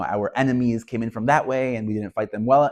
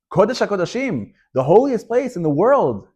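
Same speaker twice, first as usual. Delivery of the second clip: anguished outcry; 9 semitones higher, 6 dB louder than usual. Retold or imitated. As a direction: cry out over the holy place. Rabbis lament the fox.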